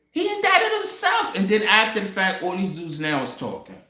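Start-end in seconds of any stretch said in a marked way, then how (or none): 0.1-1.4 much higher, stretched disbelief; high-pitched amazed repeat; incredulous repeat. Shown as a demonstration. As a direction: say it again like you can't believe it.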